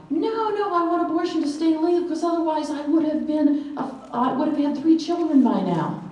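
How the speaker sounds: high-pitched mock heckler's cry; retold yell. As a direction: imitate a man calling out from the roadside. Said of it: "he yells out".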